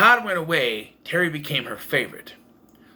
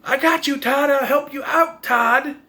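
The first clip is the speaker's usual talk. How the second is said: high-pitched mock yell; Karen yelling; 11 semitones higher, 4 dB louder than usual. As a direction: shout it up high, calling to Todd. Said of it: "Karen yelling".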